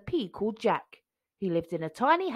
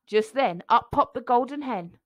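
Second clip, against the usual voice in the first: plain reading voice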